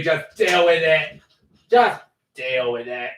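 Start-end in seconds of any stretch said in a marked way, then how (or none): 1.7-3.1 slow, lightly put-on repeat; playful mocking take. Shown as a demonstration. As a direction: echo yourself in a silly voice. Repeats it in altered voice.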